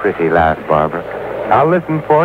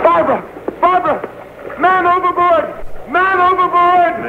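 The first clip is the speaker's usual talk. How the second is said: desperate calling out. Acted. Simon calling for help after Barbara goes overboard, desperate. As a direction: shout it out into the fog for help.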